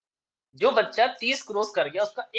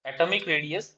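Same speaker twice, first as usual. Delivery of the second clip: slow, deliberate dictation, each phrase spaced out; 4 semitones lower, much slower than usual. Shown as a demonstration. Teacher dictating exam answer to students, slow dictation. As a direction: read out notes word by word for students to copy.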